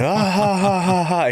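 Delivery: high spelled-out fake laugh; mock laughter. Retelling the room cracking up at his joke, mock laughter. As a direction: ham up a crowd laugh. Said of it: "Everybody starts laughing again".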